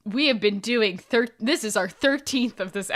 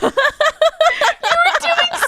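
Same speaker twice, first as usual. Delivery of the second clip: laughing; 7 dB louder, much slower than usual. Real, not acted. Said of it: "laughing breaking up".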